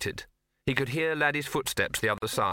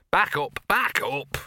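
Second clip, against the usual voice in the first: louder slow order, back up; firm command; 4 semitones higher, 5 dB louder, slower than usual. Acted. Old dog ordering the big dog to back up.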